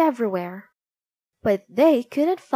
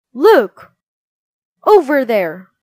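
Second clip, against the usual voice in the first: alarmed shout; 10 dB louder than usual. Acted. Soldier spotting the runaway, loud alarmed shout. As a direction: raise the alarm, pointing him out. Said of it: "he shouted".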